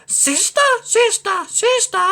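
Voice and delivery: high echo fading back; cave echo. Acted. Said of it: "a voice from the cave came back".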